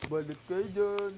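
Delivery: slow, drawn-out vendor call; balut vendor cry. Pretend. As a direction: sing it out like a street hawker. Street vendor calling customers.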